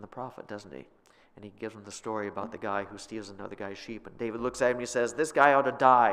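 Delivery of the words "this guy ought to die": loud, indignant outburst; outraged verdict. Acted. David furious at the sheep thief, loud outburst. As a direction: condemn the thief at full volume.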